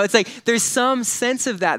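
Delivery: ordinary delivery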